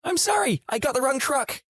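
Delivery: tiny, very high-pitched female voice; very small woman's voice. A narrator's reading. Woman to the trucker whose cab she climbed, small high voice. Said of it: "a very small woman's voice".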